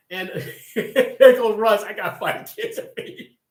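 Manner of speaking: can't hold back the laugh telling it. Real, not acted. Laughing while retelling Russ.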